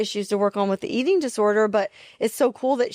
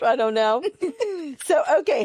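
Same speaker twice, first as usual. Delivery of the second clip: usual speaking voice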